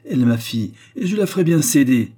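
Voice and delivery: voix montante et forte de mère intraitable; autorité furieuse. A narrator's reading. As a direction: imposer sa volonté avec colère. Mère en pleine querelle. (autorité furieuse)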